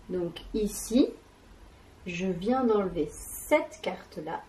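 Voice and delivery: ordinary delivery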